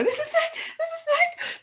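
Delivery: high, squeaky mock panic; panicked squeal. Standing on furniture, panicked cries.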